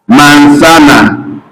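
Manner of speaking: manzana slowed with a clear S in the middle; slow central sound. Shown as a demonstration. Teacher showing the middle sound of manzana. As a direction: stretch out manzana to show the S. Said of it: "the central sound is pronounced as".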